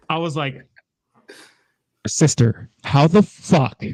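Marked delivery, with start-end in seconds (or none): 2.1-3.8 loud exasperated disbelief; loud frustrated rebuke to sister. Retold or imitated. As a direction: yell at her in disbelief.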